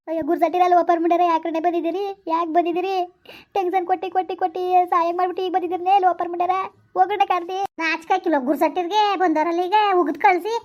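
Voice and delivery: furious scolding. Acted. Grieving mother shouting at unwelcome in-laws, furious. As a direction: drive them out with curses. Mother cursing the visiting relatives.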